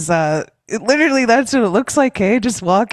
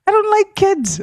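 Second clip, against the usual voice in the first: imitating daughter; 11 semitones higher, 3 dB louder than usual. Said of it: "She's like".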